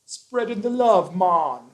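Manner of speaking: high, bright Jamaican accent. Shown as a demonstration. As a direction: say it like a laid-back Jamaican. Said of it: "That's my Jamaican accent".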